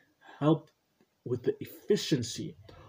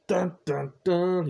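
higher, voiced suspense sting; dramatic "dun dun dun" sting; 6 semitones higher, 5 dB louder, slower than usual. Shown as a demonstration. Podcast host punctuating the segment title, mock-dramatic sting. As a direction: sound out a mock-dramatic reveal jingle. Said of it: "You gotta say it like that".

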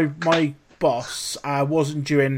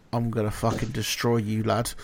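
low ear threat; 5 semitones lower, 6 dB quieter than usual. Retold or imitated. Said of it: "in my ear".

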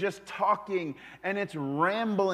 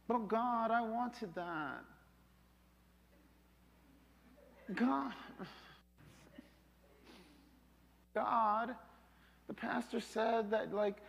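whiny mock prayer; 3 semitones higher, 8 dB quieter than usual. Congregant whining prayer, voice dropped.

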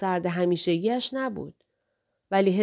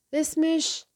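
higher, hesitant, left hanging; flustered; 7 semitones higher, much slower than usual. A narrator's reading. Fusagi trying to recall his wife's name, flustered. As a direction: grope for it in mild fluster. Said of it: "و بعد با دستپاچگی گفت".

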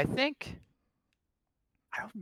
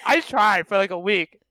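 ordinary talk, nothing marked

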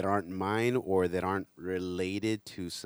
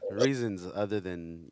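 normal talking voice

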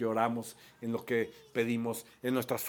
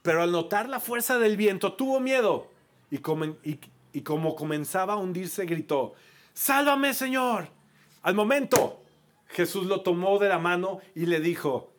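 building drama; 7 semitones higher, 6 dB louder than usual. A narrator's reading. Narración dramática creciendo.

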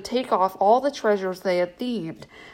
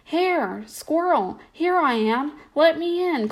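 calling out; 7 semitones higher than usual. Acted. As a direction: call through the door to be let in. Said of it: "called Gray Rabbit".

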